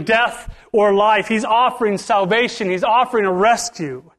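high, loud altar call; preacher pleading with the congregation; preaching at full force. Acted. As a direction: drive the call home.

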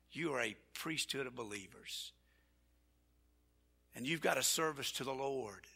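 ordinary talk, nothing marked

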